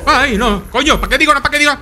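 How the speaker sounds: high-pitched rattled self-scolding; panicked regret. Real, not acted. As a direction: scold yourself while panicking. Regretting jinxing it, under attack.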